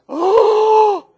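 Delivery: high, loud cry of alarm; a big scared "oh" shouted high; frightened shout. Acted. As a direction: cry out in terror at the sight. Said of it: "It was like".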